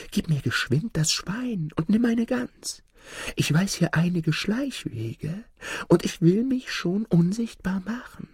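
high, quick lad's voice, ending in a whisper; a cunning boy pitching a deal; sly young lad. Acted. Lad tempting Hans to swap the pig, high and sly.

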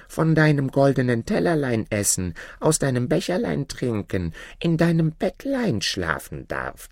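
high, wheedling frog plea; coaxing frog. Acted. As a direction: build a list of wishes high, dropping for the promise. Frog names his wishes, coaxing.

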